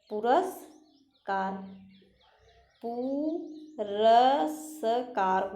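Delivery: slowly spelling it out; slow classroom dictation. Shown as a demonstration. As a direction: say it slowly while writing. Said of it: "अब देखिए".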